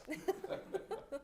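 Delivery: soft, voiceless laugh. Real, not acted. Coach laughs at his own Tourette's joke, soft real laugh.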